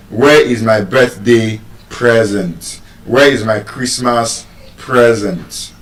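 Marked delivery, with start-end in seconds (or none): 1.9-2.8 PREzent, short e and strong start of present; stress on first syllable. Shown as a demonstration. Teacher giving birthday example, first-syllable stress. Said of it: "the stress is on the first syllable". 4.8-5.7 PREzent, front-stressed present with short e; stress on first syllable. Shown as a demonstration. Teacher giving Christmas example, first-syllable stress. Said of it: "the stress is on the first syllable".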